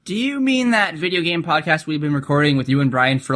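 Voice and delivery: ordinary talk, nothing marked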